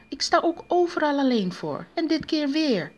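high-pitched wifely complaint; exasperated nagging; scolding. A narrator's reading. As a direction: moan at him, pitched well up. Wife scolds late husband.

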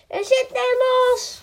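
high, loud alarmed cry; excited shout. A narrator's reading. The princess shouts about her wobbly tooth, high and loud. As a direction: yell it out, the tooth is loose. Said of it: "schreeuwde de prinses".

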